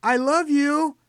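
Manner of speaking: put-on loud and high, so an old man can hear; speaking loudly. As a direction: call it out brightly to a hard-of-hearing grandfather. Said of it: "she spoke loudly so he could hear".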